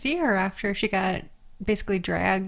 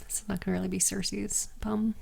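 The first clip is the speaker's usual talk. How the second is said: whispering; 8 dB quieter than usual. Real, not acted. Whispered aside.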